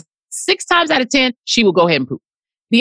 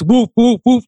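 spoken woofs; playful mock barking; imitated dog bark; 3 dB louder, slower than usual. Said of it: "she barks like".

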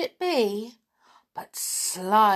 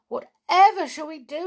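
high, louder despairing plea; desperate cry; 9 semitones higher, 6 dB louder, faster than usual. A narrator's reading. Teddy bear cries to the toys in despair. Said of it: "he cried to the toys".

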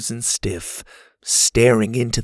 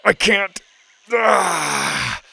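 high, agonised groaning; pained moan; 8 semitones higher, much slower than usual. A narrator's reading. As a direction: struggle to speak through the pain. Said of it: "Kirby moaned".

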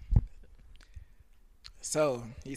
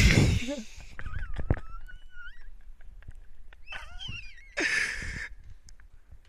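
long high laughing fit. Real, not acted.

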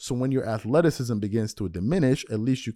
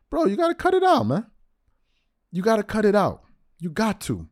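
much higher, insistent scolding; 9 semitones higher than usual. Real, not acted. Host scolding Gilbert Arenas, high and insistent. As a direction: tell him to knock it off.

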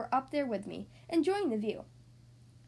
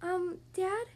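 put-on higher, tentative kid voice; small, raised child voice; hesitant daughter; 6 semitones higher than usual. A narrator's reading. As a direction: timidly try to get dad's attention. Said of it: "Kitty yanked on Catadon's sleeve".

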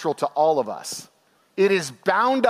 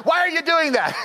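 exasperated parent; 10 semitones higher than usual. Retold or imitated. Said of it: "You're like".

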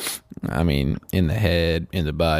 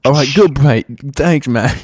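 mock British cheer; 8 semitones higher, 7 dB louder than usual.

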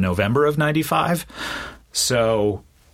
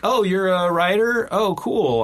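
higher-pitched, interested greeting; lightly acted, raised-pitch friendly small talk; imitated comedy mentor; 9 semitones higher than usual. Comedian meeting a fellow writer at a party, imitated interest. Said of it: "But he was like".

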